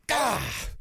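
ordinary talk, nothing marked

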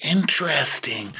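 drawn-out high "oh", then slow "interesting"; pointed mock intrigue. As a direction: stretch it out as if you've caught someone. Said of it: "Rinna goes".

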